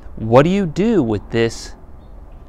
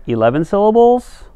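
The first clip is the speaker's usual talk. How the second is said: a much higher, louder mock question; mock disbelief; 10 semitones higher, 7 dB louder than usual. Teacher pretends to be shocked at eleven syllables, with a high, loud mock question. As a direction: sound shocked for the class's benefit.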